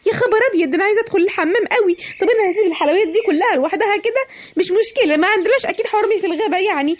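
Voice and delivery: put-on a seller hawking sweets to the children, cheerful and high; cheery candy seller.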